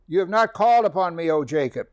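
preacher rebuking Jacob in God's voice; proclaiming scripture. Acted. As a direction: call it out like a rebuke from God. Scripture repeated.

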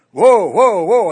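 loud, high cries to halt; panicked shout. Acted. Rider to a horse that won't slow down, panicking. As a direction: frantically call a runaway horse to stop. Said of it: "he's really panicking this time".

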